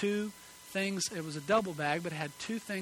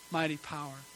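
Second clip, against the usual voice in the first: ordinary talk, nothing marked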